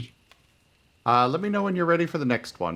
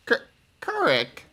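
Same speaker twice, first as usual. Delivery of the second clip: put-on timid child; 9 semitones higher than usual. Voicing shy child stammering.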